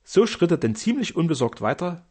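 plain reading voice

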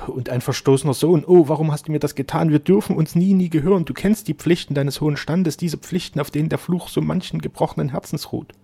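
high, loud, impassioned reproach; anguished lament. A narrator's reading. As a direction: cry out against a forbidden love.

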